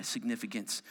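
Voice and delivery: normal talking voice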